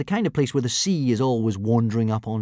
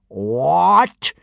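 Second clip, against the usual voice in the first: a louder, higher bellowed What; 6 semitones higher, 7 dB louder than usual. Acted. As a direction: bark it at the boys. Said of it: "he bellowed".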